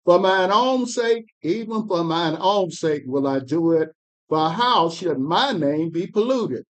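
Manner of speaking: measured, solemn Bible reading; scripture reading. Acted. Reader to study group, solemn scripture reading. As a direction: read the prophet's words with weight.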